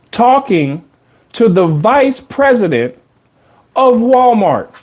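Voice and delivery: high-pitched, incredulous storytelling in separated phrases; amazed emphasis. Real, not acted. Marvels at his unlikely situation.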